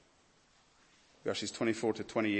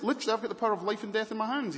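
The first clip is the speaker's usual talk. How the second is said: fast boast; 10 semitones higher, much faster than usual. Acted. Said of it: "He's, he's furious".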